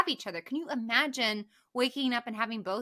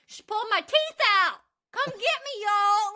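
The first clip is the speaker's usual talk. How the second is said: high, loud hammed-up victim voice; mocking high voice; 10 semitones higher, 6 dB louder than usual. Parodying the missing friend, high mock voice. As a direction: play the toothless victim for laughs. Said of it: "mocking the missing friend".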